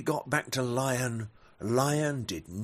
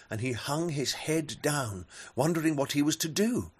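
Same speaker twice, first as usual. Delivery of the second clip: ordinary delivery, nothing marked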